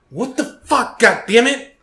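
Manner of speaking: high exasperated swearing, rising; frustrated outburst. Retold or imitated. Retelling a ruined achievement run, high exasperated swearing. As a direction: vent like the run just got ruined. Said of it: "I was like".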